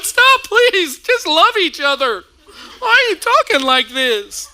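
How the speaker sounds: high, loud begging with a laugh; pleading child. Retold or imitated. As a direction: beg the parents to stop, half laughing. Child begs fighting parents.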